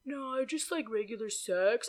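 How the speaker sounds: soft, hushed impression; imitated mumbling. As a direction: imitate his quiet insistence. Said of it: "that's how he was talking".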